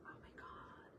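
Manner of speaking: hushed breathy oh my God to herself; whispered aside. Real, not acted. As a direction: drop to a whisper, amazed. Aside about plain steak.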